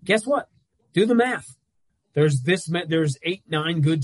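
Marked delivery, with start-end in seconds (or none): 0.0-0.5 high, loud needling "Guess what?"; mocking taunt. Taunting teams who feel insulted. 0.9-1.6 mocking challenge. Taunting teams who feel insulted.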